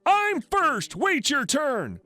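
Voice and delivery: very high cry, slowing on the second phrase; indignant yell. A narrator's reading. Crazy lady silences site manager, indignant high yell. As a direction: demand priority in a high mock yell. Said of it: "and yells".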